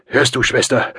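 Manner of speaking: high, loud, strained call; desperate effortful shout; mustering last strength. A narrator's reading. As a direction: call after her with your last strength. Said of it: "unter Aufbietung seiner letzten Kräfte".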